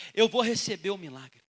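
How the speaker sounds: full-voiced proclamation, punched at the start; firm declaration. Woman proclaiming faith in her miracle, firm declaration. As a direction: declare it out with conviction. Same resolve repeated out loud.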